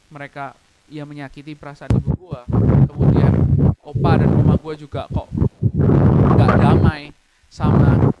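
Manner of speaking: loud, breaking, near-sobbing prayer; real tears welling up while praying; emotional outburst, voice cracking with crying; tearful prayer. Real, not acted. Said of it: "Nangis, men".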